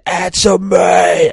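high, loud, slow drawn-out yell; furious shout. Acted. Hope screaming at Cyclops before the Phoenix bursts out, furious shout. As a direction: demand it with all your rage.

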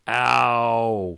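loud comic yelp of pain; over-the-top ow; hammed-up scream. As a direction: yell it like a stabbed vampire. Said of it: "screamed [name]".

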